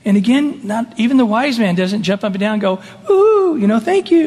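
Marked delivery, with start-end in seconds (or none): 3.1-4.2 much higher, louder, hammed-up gratitude; mock gushing thanks. Pastor mocking a wise man's gushing thanks, high and hammy. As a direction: gush like an overjoyed fan.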